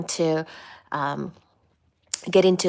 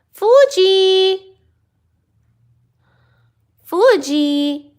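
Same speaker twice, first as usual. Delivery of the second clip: high loud call across the house; loud high call for Fuji; calling the cat; 9 semitones higher, 6 dB louder, much slower than usual. Real, not acted.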